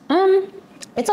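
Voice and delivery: chewing while talking; speaking after a bite; mouth full. Real, not acted. Seen 1: Bites bun at -1.0; lips stay closed, chewing through "um.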